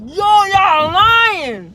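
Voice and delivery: drawn-out, loud "no way" cry of disbelief; stunned disbelief. Retold or imitated. Hearing the teacher cried, shocked disbelief. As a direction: shout it slowly, half laughing, like you can't believe it.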